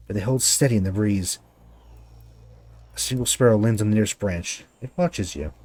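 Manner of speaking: ordinary talk, nothing marked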